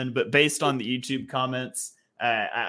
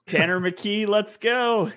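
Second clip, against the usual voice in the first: higher-pitched, rallying cry; playful hype cheer; 8 semitones higher, slower than usual. Pretend. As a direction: cheer the pick on in mock triumph. Joking cheer for backup quarterback.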